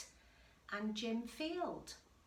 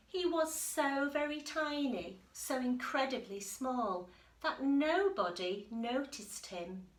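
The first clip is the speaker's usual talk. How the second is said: lively read-aloud voice, a little louder; story narration; 2 semitones higher, 4 dB louder than usual. A narrator's reading. Teacher reading the picture book to the class, lively storytelling. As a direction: read it to the children with bounce.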